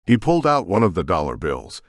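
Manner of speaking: plain reading voice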